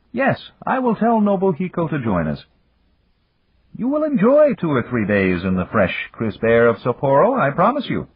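high, measured executive voice; Japanese businessman voice. Acted. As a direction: voice him high and formal. Yamagata speaking in story.

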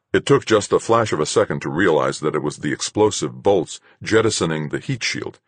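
ordinary delivery, nothing marked

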